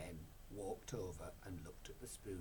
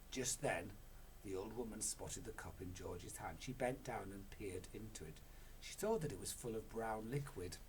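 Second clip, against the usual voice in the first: ordinary delivery, nothing marked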